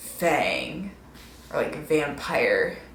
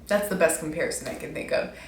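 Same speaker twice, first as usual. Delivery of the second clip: usual speaking voice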